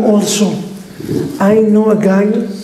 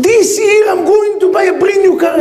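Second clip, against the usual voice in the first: son's gleeful brag; excited shout; 9 semitones higher than usual. Retold or imitated. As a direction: belt it out like a thrilled son. Son announcing car again.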